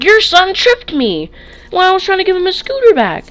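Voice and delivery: high, loud, outraged tattling; indignant complaint. Retold or imitated. To the kid's mom, a loud outraged complaint. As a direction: tell on the kid at full volume.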